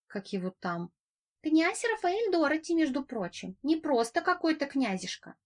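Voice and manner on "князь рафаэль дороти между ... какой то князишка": high buzzing insect voice. Acted. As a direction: buzz the words out high and proud. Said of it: "Жужжащий голос".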